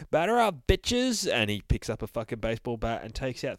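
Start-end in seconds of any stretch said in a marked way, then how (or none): 0.1-1.3 high, loud gleeful taunt; taunting yell. Acted. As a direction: shout it swinging the bat. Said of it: "He's like".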